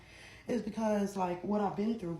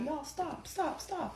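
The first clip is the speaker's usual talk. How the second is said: mock pleading mother; 8 semitones higher, 5 dB quieter, much slower than usual. Mother pleading amid fight.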